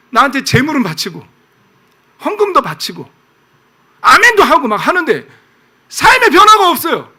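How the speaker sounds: indignant list building to a shout; high, loud reproach, peaking at the end; God. Acted. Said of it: "하나님이 이러는 거예요". (점점 언성을 높여 꾸짖는 하나님)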